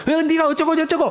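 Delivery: imitating a furious outburst; mock angry rant. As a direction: play someone fuming and storming off. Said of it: "너무 화가 나면".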